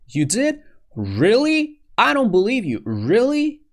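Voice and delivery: high-pitched, rising disbelief; surprised. Shown as a demonstration. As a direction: echo it back as an astonished question. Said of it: "they say it to show their surprise".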